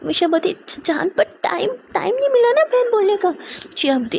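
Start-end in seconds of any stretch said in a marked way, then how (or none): 0.7-3.3 nervous stammering excuse. Acted. Misha stammers excuses to angry Jiya.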